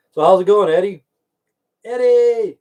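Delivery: loud cheerful hello; excited greeting. Real, not acted. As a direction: call out his name warmly. Greeting a viewer loudly.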